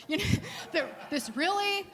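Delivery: laughing at the strangeness of it; telling the story with amused laughter. Real, not acted.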